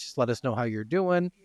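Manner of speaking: ordinary delivery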